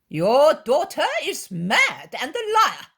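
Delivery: loud, high, slow contempt; insulting. Acted. Scribe insulting the weeping mother, contemptuous. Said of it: "the scribe offends her".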